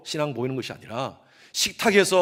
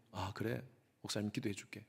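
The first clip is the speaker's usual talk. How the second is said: murmured kindly, much softer; soft pastor's reply; 6 semitones lower, 16 dB quieter than usual. Retold or imitated. Pastor softly answering students at dawn prayer. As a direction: softly reassure the praying students.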